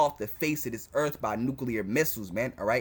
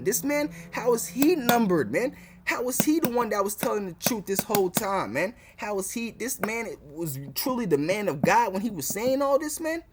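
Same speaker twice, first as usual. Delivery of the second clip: higher, marvelling mock voice of the crowd; imitating amazed doubters; 6 semitones higher than usual. Preacher voicing future doubters to his listeners, higher marvelling mock. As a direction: play the stunned unbelievers finally seeing it. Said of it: "this is what y'all gonna be saying".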